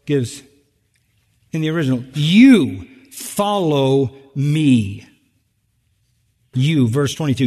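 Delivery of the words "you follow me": "You" punched loud and drawn out, very slow; stress on YOU. Shown as a demonstration. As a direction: lean hard on "You" in "You follow me". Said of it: "this is emphatic".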